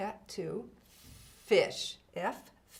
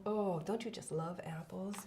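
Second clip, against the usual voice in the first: ordinary talk, nothing marked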